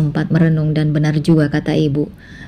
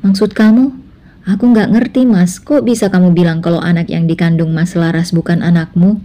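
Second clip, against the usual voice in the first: put-on a young wife asking her husband in disbelief; puzzled Anissa; 3 dB louder than usual. Anissa's voice in the story. (Anissa bingung, suara lebih tinggi)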